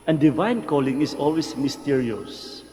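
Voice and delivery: ordinary delivery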